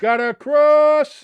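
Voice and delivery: high, loud, drawn-out call; excited match call. Real, not acted. Commentator watches a cross come in, excited stretched shout. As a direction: stretch it out as the ball comes over.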